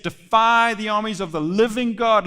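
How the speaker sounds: loud, ringing defiance as David; clear confidence. Acted. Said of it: "with clarity and confidence".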